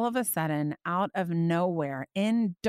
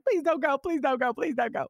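pleading; 6 semitones higher than usual. Retold or imitated. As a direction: beg him not to leave. Pleading with God in retelling.

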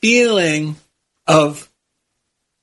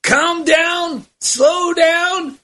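Eddie Murphy impression; 9 semitones higher, much slower than usual. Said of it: "it's Eddie Murphy".

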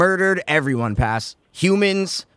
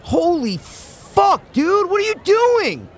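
high shocked outburst; shocked high yell; 14 semitones higher than usual. Acted. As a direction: freak out in disbelief. Intro skit, shocked reaction.

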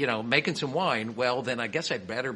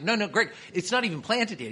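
high-pitched mock protest; retold flustered objection, pitched well up; acted protest; 9 semitones higher than usual. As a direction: plead your case in a higher voice. Acted protest to winemaker friend.

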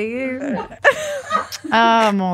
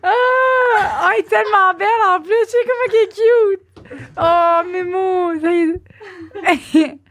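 gushing; 10 semitones higher than usual. Real, not acted. Gushing over a cute pet.

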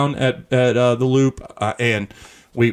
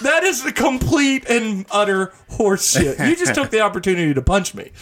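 mock outraged shout; 6 semitones higher than usual. Arguing with his wife over the punch, a hammed-up indignant shout. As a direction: bellow the accusation, hamming up the outrage. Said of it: "And I'm like".

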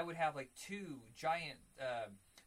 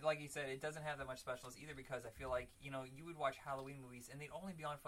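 ordinary talk

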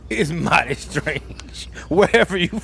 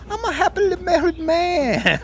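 mock protest; 12 semitones higher than usual. Jokey protest about loving ladies.

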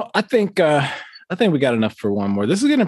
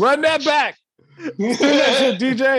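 high, laughing plea to replay; excited laughing; 12 semitones higher, slower than usual. Real, not acted. Urging the host to replay the clip, high and laughing. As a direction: squeal it through laughter.

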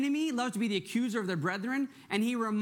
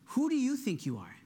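less pitched-up, searching question; Holy Spirit asking; 4 semitones higher than usual. Acted. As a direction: ask it sincerely as the Holy Spirit. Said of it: "the Holy Spirit is asking you this morning".

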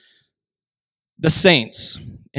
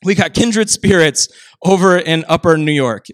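ordinary talk, nothing marked

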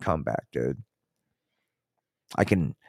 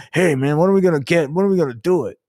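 higher, louder whiny dude voice; hammed-up pleading, pitched way up and pushed; mock eager dude; 9 semitones higher, 6 dB louder than usual. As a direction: play a needy guy begging, high and loud. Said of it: "You, you know".

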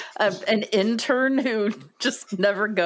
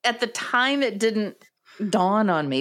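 ordinary talk

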